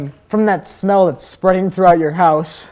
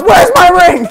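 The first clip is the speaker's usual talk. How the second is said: very high, loud acted bellow; explosive, high-pitched shouted question; full yell; 12 semitones higher, 8 dB louder, slower than usual. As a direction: bellow the demand at the top of your voice. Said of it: "Don't yell at me".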